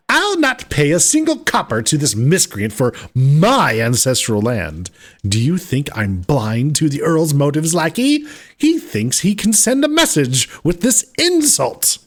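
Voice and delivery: indignant lord rising to fury; scornful noble confronting a lackey; angry nobleman. Acted. Nobleman refusing, growing indignant.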